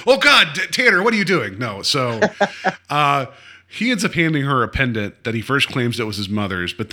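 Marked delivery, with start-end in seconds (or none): 0.1-1.6 higher, louder alarmed outburst; mock alarmed cry. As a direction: blurt it out in startled disgust. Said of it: "And she's like".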